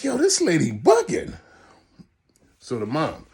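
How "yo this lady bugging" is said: much higher disbelieving exclaim; incredulous aside. Retold or imitated. Storyteller recalls thinking the mom was crazy, high incredulous aside. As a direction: react like you can't believe her. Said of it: "And I'm like".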